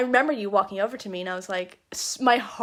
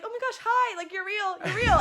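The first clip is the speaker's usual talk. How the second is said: much higher, breathless excited squeal; 10 semitones higher than usual. Retold or imitated. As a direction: replay her own starstruck greeting. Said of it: "high voice when you're, like, really excited".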